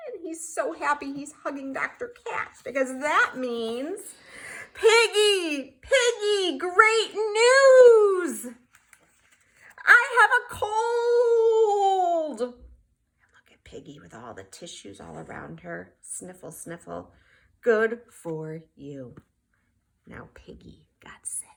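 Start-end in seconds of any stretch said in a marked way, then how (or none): 4.7-12.4 high and loud, laughing with relief; joyful laughing. Acted. Gerald overjoyed to Piggy. 12.4-21.5 hushed whisper, very soft; whispered aside. A narrator's reading. Quiet narration ending.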